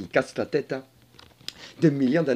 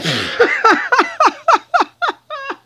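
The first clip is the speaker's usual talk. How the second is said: loud high burst of laughter; high helpless cackling; 9 dB louder than usual. Real, not acted. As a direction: crack up in a long string of ha-has. Cracks up at own joke.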